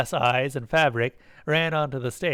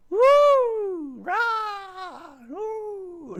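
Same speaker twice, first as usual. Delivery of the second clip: very high falsetto whoops; high cheers, fading away; yelling creatures; much slower than usual. A narrator's reading. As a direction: whoop it like tiny monsters. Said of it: "the Azatoths yelled".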